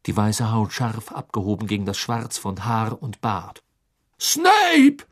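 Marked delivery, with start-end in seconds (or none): 4.2-5.1 sharp high cry; shouted name. A narrator's reading. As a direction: spit the name out loud. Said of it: "rief er". (lauter Ausruf)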